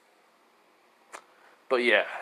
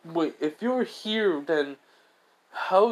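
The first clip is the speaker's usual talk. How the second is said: confused Naruto; 5 semitones higher, slower than usual. Acted. Naruto confused, questioning Kratos.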